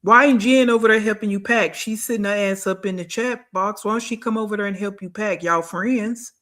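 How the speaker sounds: ordinary delivery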